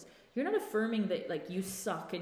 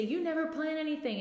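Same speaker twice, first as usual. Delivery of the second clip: mock accusation; 9 semitones higher than usual. Partner's accusation acted out.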